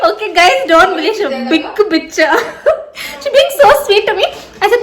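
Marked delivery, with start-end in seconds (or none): 2.2-4.8 laughing. Real, not acted. Repeats it while laughing.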